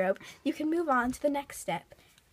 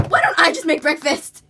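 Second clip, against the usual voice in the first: exasperated; 2 semitones higher, 11 dB louder than usual. Acted. Exasperated take of the line.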